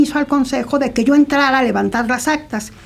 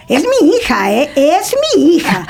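higher, emphatic mock possessiveness; jealous father; 4 semitones higher than usual. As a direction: play the jealous dad laying his claim, slowing on the repeat. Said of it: "era tan celoso que decía". (padre celoso imitado, enfático y más agudo)

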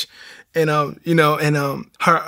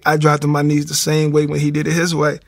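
ordinary delivery

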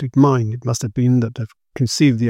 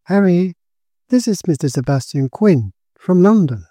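raised, louder introduction; girl announcing; 5 semitones higher, 4 dB louder than usual. A narrator's reading. Girl introducing Quinn to her dazed fiancé, announcing. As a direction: announce the visitor clearly. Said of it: "the girl announced".

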